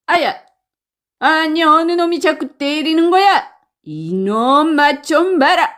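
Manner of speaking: high, indignant outburst after a yelp; pained yelp into fighting threat; angry bandit. Acted. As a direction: lash out at whoever keeps hitting you. Bandit hit again, strikes back.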